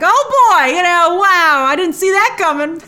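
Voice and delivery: high, loud, delighted exclamation; whooping mock astonishment; imitated gleeful amazement. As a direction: act out someone thrilled by a surprise. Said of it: "he's having a great time".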